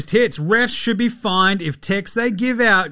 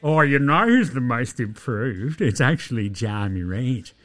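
mock dork; 5 semitones lower than usual. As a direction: drag it out like a pompous know-it-all dork. Mocks the dorks being smart.

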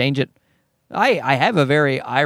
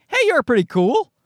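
mock friendly approach; 10 semitones higher than usual. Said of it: "and being like".

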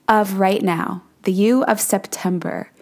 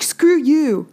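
rude punk; 7 semitones higher than usual. Retold or imitated. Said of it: "And he's like".

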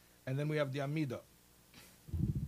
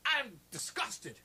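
high, slow, disgusted declaration; mock disgust; 10 semitones higher, 3 dB louder, slower than usual. Visitor telling the sexton he is disgusted, mock slow. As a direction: drag it out in hammed-up disgust. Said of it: "So he's like".

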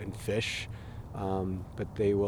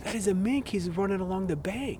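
high, eager, louder; excited; 10 semitones higher, 4 dB louder than usual. Real, not acted. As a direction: point the mink out with delight. Spotting a mink at night.

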